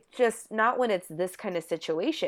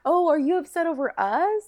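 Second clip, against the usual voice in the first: put-on pitched up girlish quote; high mock voice; 7 semitones higher, 5 dB louder than usual. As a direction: squeak out the reply. Voicing Jenna, high.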